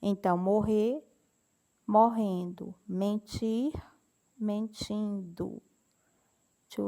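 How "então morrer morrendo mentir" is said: normal talking voice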